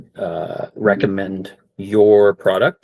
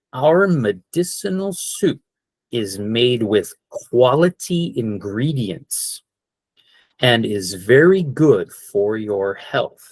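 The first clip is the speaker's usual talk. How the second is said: slow model phrase; slower than usual. Shown as a demonstration. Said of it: "you guys could say something like".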